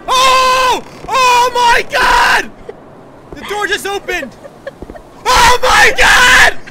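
high, very loud terrified shouts; panicked screaming. Real, not acted. Panicking as game monster appears.

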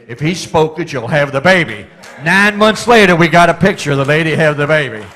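building preaching shout. Real, not acted. Preacher to his congregation, after the baby story, shouting the point. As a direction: build it up till the whole church hears the climax.

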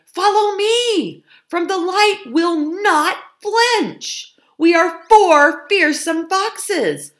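high, loud, drawn-out rallying cry; loud bold cry. A narrator's reading. Fox leader rallying his family to the farmyard, crying out boldly. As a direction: cry out slowly like a fearless leader. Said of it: "Cried Frank".

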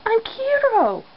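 high, indignant little voice; Kiro. Acted. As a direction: protest in a squeaky high pitch. Kiro protests being called girly.

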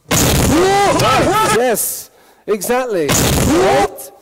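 squeaky high yelps, loud; high excited exclamation. Acted. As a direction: burst out in a high shriek.

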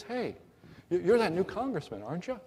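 a hint of an excited boy meeting a celebrity; Boy Scout. Boy Scout voiced in joke.

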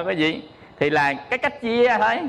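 normal talking voice

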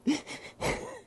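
a high breathy laugh through tears; teary laugh. Real, not acted. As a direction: laugh as the emotion breaks through. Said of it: "I'm very emotional still".

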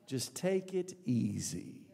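very slow, soft and low; relaxed, drawn-out murmur to oneself; easygoing self-talk. Acted. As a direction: lull yourself, drawing the words out. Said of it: "saying that to himself".

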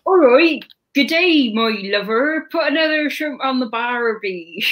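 Australian attempt sliding into Bristol and Somerset; botched Australian accent. Co-host attempting Australian on a live stream, comes out West Country. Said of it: "That was more Bristol and Somerset".

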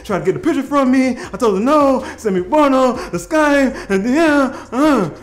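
loud, high agitated rant; heated. Retold or imitated. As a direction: blurt out the whole story angrily. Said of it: "I'm heated".

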